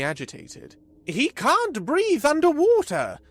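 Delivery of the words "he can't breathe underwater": high, loud, urgent blurt. A narrator's reading. Vrodax bursting out in fear for Carlos, loud and high. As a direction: burst out in alarm. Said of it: "he finally blurted out".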